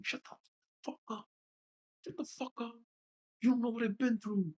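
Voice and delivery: high, strained retort; exasperated venting. Real, not acted. Venting at people who criticized cursing.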